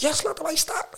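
squeaky high voice for the teammate; high-pitched eager teammate; high mock teammate voice.

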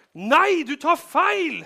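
very loud, high shouted no; furious denial. Acted. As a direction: shout her down in panic. Peter denies loudly. (rasende, høyt nektende rop)

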